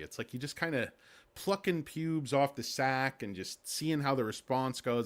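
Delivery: normal talking voice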